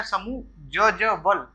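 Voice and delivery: समु ज ज वल broken into slow separate chunks; spelled out slowly. Shown as a demonstration. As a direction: slowly split समु ज ज वल so each ज is heard. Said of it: "दो बार ज आया है आधा आधा". (समु ज ज वल धीरे धीरे अलग अलग बोलना)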